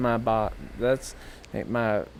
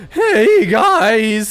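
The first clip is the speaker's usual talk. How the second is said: squeaky puberty voice, very high and loud; mock a cracking teen squeal; Michael; 10 semitones higher, 10 dB louder than usual. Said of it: "I hate when Michael's like".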